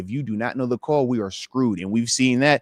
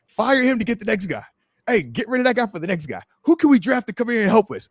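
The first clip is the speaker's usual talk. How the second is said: lightly mocked fan demand, pitched up; imitating impatient fans, higher voice; 7 semitones higher than usual. Player mimics fans demanding the next guy, mock urgency.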